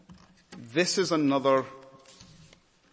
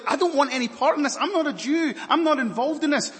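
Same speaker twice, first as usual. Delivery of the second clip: high, loud protest; Pilate washing his hands; 14 semitones higher, 7 dB louder, faster than usual. Acted. Pilate refusing any part in this, high and loud. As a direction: brush it all off nervously. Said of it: "Pilate is now like".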